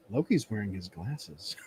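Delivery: hushed aside. Retold or imitated. Aside to Akemi about Loki.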